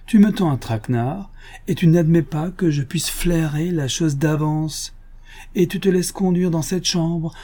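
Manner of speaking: lightly voiced gentleman thief, teasing; mock friendly, toying with him; sly Lupin. Lupin teases the inspector about the silk, lightly voiced.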